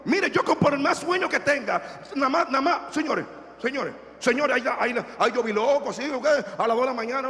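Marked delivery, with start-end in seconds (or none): none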